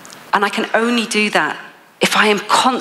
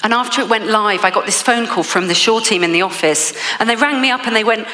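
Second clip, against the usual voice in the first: ordinary delivery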